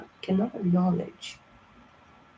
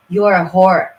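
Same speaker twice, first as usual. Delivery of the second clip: much louder, berating tough love; angry shout; 6 dB louder than usual. Real, not acted. Reader yelling at fire signs to repent, loud. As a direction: yell them into action. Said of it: "I hope I was not too crazy".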